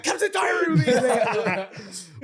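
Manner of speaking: hammed-up producer voice, laughing; stern producer. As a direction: summon him like a stern producer. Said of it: "the producer was like".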